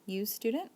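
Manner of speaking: "You student" rising sharply on student; rising question. Shown as a demonstration. Teacher showing ASL word order as a question, rising tone. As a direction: ask "You student" with the voice going up at the end. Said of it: "the voice goes up".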